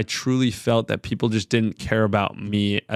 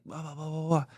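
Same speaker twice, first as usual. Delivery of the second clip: high, much softer babble standing in for the swearing; mock gibberish rant; 6 semitones higher, 9 dB quieter than usual. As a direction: garble the cussing, light and distant. Stands in for cussing out the ref.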